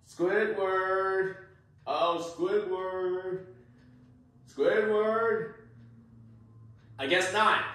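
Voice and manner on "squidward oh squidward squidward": very slow sing-song call; coaxing the neighbour to answer, very slowly; drawn-out call. Acted. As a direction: stretch the whole call out long.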